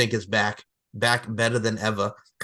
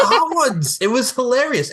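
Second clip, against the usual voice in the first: excited; 13 semitones higher, 6 dB louder than usual. Real, not acted. Excited recalling the show.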